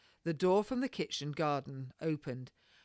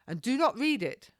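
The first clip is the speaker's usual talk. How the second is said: called-out order; 8 semitones higher, 7 dB louder than usual. Acted. Parting order to maid.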